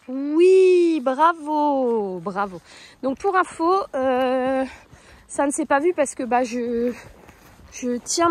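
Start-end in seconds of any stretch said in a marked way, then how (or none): 0.1-2.6 oui lancé fort et haut, puis bravo; féliciter la jument avec élan; bravo enthousiaste. Real, not acted. (la cavalière encourage sa jument, avec élan)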